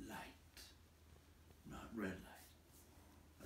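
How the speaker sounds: soft unvoiced whisper, slow; hushed whisper. A narrator's reading. Narrator hushing as Bilbo glimpses light, whispered. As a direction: breathe the words in awe.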